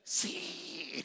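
breathy, unvoiced mock yes; hushed confirmation. Preacher acting out a gossip confirming who it was, hushed. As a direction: draw out a secretive whispered yes. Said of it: "Y usted le dice".

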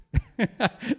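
brief chuckle at his own memory; a real, short laugh. Real, not acted. Preacher recalling his conversion, short laugh. Recalls crying when accepting Jesus.

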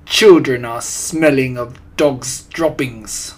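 put-on loud, slow, accented witch; screeched. A narrator's reading. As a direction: proclaim it slowly and forcefully. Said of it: "screeched the Grand High Witch".

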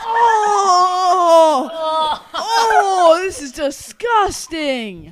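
loud, slow disgusted yell; disgusted shout. Real, not acted. Reacts to eating gross mix.

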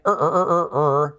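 Er, er with a curled r; English r ending. Shown as a demonstration. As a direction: hold the r sound. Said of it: "con acabado en R".

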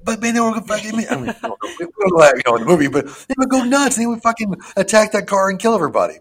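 high, loud, fast excited rant with a laugh. Real, not acted. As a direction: get worked up about the ending. Excited rant about ending.

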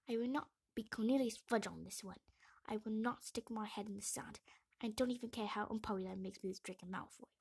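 forceful, determined statement; defiant declaration. A narrator's reading. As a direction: dig your heels in, firmly. Hermione declares she will act.

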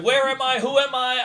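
high, loud panicked questions; panicked confusion. Retold or imitated. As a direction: act out waking up totally lost. Reenacting waking up disoriented.

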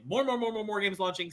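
soft muttered repeat; quiet. Pretend. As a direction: drop it to a murmur. Hyped announcement then quiet repeat.

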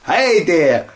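high mock Canadian. Mock Alberta chart host, high Canadian. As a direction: ham up a Canadian hello. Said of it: "I'm from Alberta".

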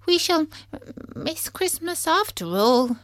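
high, tearful little voice; hammed-up sniffly upset; sniffling. A narrator's reading. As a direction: whimper with disappointment. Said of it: "he sniffed".

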